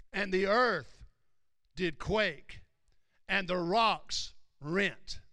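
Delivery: loud, emphatic sermon delivery; forceful preaching. Real, not acted. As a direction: preach it out with weight on each phrase. Preaches the torn veil.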